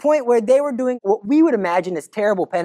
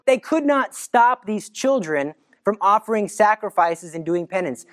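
ordinary talk

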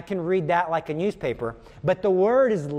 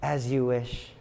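soft, lower, devoted murmur; lower, softer lovestruck reply; devoted Wesley; 6 semitones lower, 4 dB quieter, slower than usual. Retold or imitated. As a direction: say it like a quiet vow of love. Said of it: "And Wesley says".

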